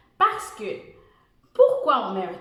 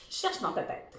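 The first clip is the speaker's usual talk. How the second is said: whisper; 9 dB quieter than usual. Real, not acted. Prompting child to think.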